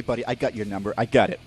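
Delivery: normal talking voice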